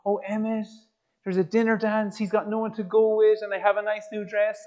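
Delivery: high put-on girl voice, running on; mock-pleading girlfriend. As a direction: imitate her making excuses, pitched high. Acts out girlfriend making excuses.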